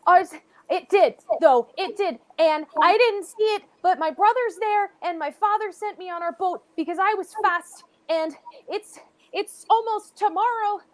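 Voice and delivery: put-on panicked child begging strangers for help; scared boy pleading. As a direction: beg for help as a desperate young boy. GM voices frightened boy.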